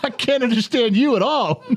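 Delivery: high-pitched mock ignorance; mock cluelessness. Husband taunting the guards who swarmed him, mock cluelessness.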